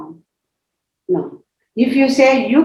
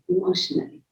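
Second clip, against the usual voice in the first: whispered echo; 11 dB quieter, faster than usual. Shown as a demonstration. Repeats word, second time whispered.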